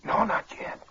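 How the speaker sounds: hoarse, half-whispered head-cold voice; faked hoarseness. Impostor reassuring Uncle Jim about his cold, faked hoarseness. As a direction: pretend a cold has taken the voice. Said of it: "This cold's made me hoarse".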